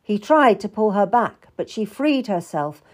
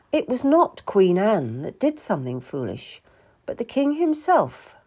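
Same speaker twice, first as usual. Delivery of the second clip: ordinary delivery, nothing marked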